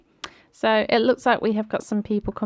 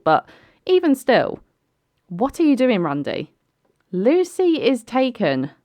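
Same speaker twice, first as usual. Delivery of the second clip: exasperated scolding; 5 semitones higher, 3 dB louder than usual. Real, not acted. Scolding a game character.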